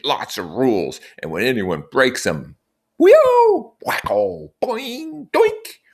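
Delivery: put-on high, zany boy with silly noises; Jack gleeful. Jack jumping up at the assembly, gleeful with cartoon noises. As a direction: relish the punishment sounds.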